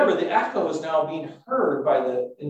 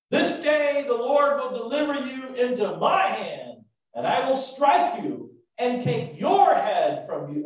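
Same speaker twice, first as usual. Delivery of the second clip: raised, emphatic declaration; proclamation; 7 semitones higher than usual. Acted. David tells Goliath the Lord will deliver him, proclaiming. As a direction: proclaim it high, stressing key words.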